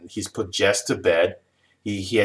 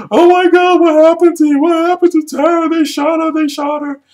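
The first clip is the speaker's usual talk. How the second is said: high, loud mock panic; freaking out; 10 dB louder than usual. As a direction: imitate a hysterical bystander, pitch way up. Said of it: "freaking out".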